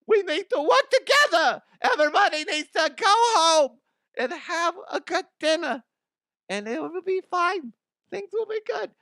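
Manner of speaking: high, loud brassy mother voice; mama voice. Acted. Mama the Huff laying down the law as president, high brassy mama voice. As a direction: boss everyone around like a tough mama. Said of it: "get in the mama voice".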